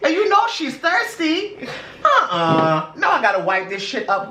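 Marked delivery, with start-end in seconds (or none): 0.0-1.6 high, laughing complaint. Real, not acted. Laughing complaint about the spill.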